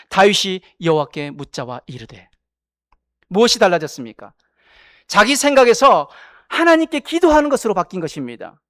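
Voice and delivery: ordinary talk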